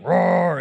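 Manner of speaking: hammed-up mock roar; unconvincing lion scare noise; bad-costume roar. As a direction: roar like someone in a cheap costume. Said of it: "like a bad Halloween costume".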